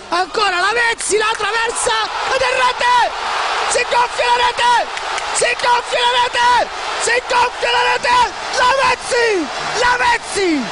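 rising excited shout; goal call. Real, not acted. Commentator calling Lavezzi's goal, rising shout.

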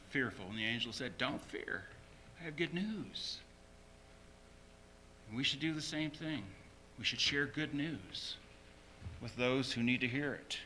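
usual speaking voice

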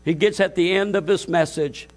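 usual speaking voice

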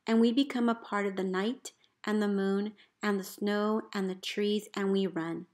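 ordinary delivery

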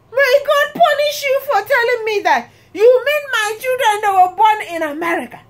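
high, indignant mock rant; outraged sister. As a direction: imitate a scandalized sister, rushed and high. Retelling sister's outraged reply.

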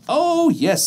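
high, loud delighted bees; eager bees voice. Acted. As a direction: gush like happy bees. Bees agree.

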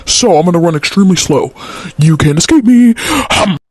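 normal talking voice

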